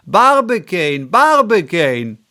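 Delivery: loud, high calls across the clearing; calling out loud. A narrator's reading. Ardent calling to his silent friend, loud shout.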